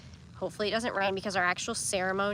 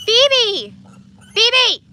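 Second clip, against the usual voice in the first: calling out; 14 semitones higher, 13 dB louder than usual. Real, not acted. Calling the dog to stop.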